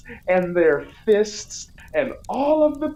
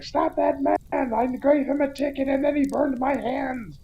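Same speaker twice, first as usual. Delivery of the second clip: higher-pitched mock cop call; put-on cop complaint, pitched up; imitating pursuing cop; 8 semitones higher than usual. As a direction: play an aggrieved cop calling out in pursuit. Cop chasing and yelling after Arnold.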